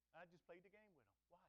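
normal talking voice

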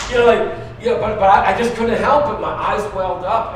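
ordinary talk, nothing marked

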